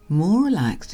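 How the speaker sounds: ordinary delivery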